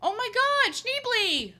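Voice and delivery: high, very loud catchphrase holler; mock excited shout. Streamer quoting a film line for fun, hammed-up shout.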